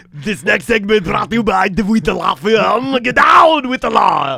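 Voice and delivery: slow, loud Austrian bodybuilder shout; Arnold Schwarzenegger. Retold or imitated. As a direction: bark it like an Austrian muscleman. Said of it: "talk like an Austrian bodybuilder".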